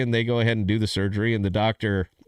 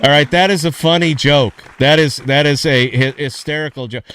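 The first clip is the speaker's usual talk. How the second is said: loud insisting; 4 semitones higher, 9 dB louder than usual. Real, not acted. Insisting joke is funny.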